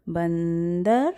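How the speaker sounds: बंदर slowly, with the nasal sound drawn out; nasal demo word. Shown as a demonstration. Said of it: "आप बोल के भी देखिए जरा". (बंदर को धीरे, नाक से खींचकर बोलें)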